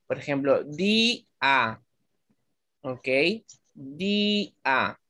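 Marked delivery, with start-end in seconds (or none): 0.6-1.8 "di-a" in two separate beats, di, a; "di-a" with the i held and a apart; split vowels. Shown as a demonstration. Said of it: "no se produce diptongo". 3.7-5.0 "di-a" in two separate beats, di, a; "di-a" with the i held and a apart; split vowels. Shown as a demonstration. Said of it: "No se produce un diptongo".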